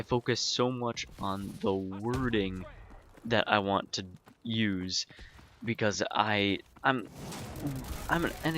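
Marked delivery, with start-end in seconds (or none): 6.1-8.6 halting false starts with pauses; choking on words. Real, not acted. Deliberate speaker hunting for wording, choking on words. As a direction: stumble and restart while searching for exact wording. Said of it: "choking on my words like that".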